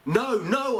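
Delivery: high frantic cry of denial. Acted. Peter denying to the crowd, high desperate cry. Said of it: "I cry out".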